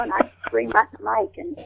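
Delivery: normal talking voice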